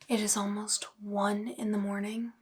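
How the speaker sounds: late-night whisper, unvoiced. Real, not acted. Whispering to viewers at one in the morning. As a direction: whisper so nobody wakes.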